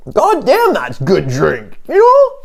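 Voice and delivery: high comic voice with a drawn-out finish; supposed real voice. Acted. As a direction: exclaim in a high mock voice. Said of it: "if this was my voice".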